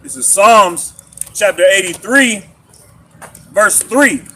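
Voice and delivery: loud, slow, emphatic scripture recital; scripture reading. Acted. Reading the Psalm out to the group, loud scripture recital. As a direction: proclaim each phrase of the verse.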